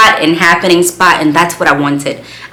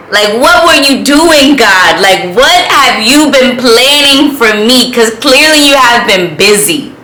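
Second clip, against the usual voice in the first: higher and louder, thrilled questions; excited disbelief; 7 semitones higher, 4 dB louder than usual. Real, not acted. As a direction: marvel at what God has planned.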